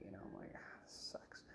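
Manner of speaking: whispered groan on surgery morning; whispered dread. Retold or imitated.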